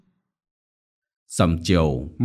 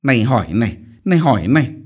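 furious scolding; 3 semitones higher, 5 dB louder, much faster than usual. A narrator's reading. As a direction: scold while striking her. Mistress beats the maid. (vừa đánh vừa mắng)

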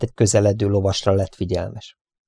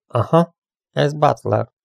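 slow, higher musing; absent-minded; 6 semitones higher, slower than usual. A narrator's reading. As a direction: say it slowly, half lost in thought. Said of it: "mormogta szórakozottan".

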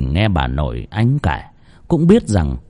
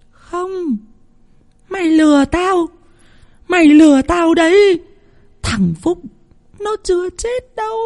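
much higher, wild denial of a madwoman; a deranged grandma refusing the truth; crazed old woman. Acted. Bà Phú rejecting the news of her grandson, high crazed voice.

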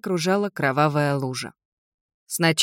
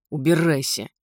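ordinary delivery, nothing marked

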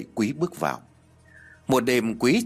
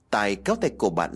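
plain reading voice

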